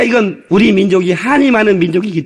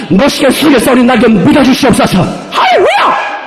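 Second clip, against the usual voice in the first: joyful bold prayer; 3 semitones higher than usual. Shown as a demonstration. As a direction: claim it boldly with joy. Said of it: "아, 이렇게 좀 하다 이 말이에요".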